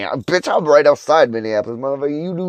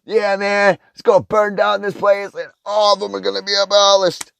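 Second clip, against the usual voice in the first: higher, lightly put-on dumb dude voice; mock couch dude; 9 semitones higher than usual. Ranter mocks a couch protester, higher and lightly voiced. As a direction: mimic a naive guy on his couch. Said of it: "sitting on your couch thinking".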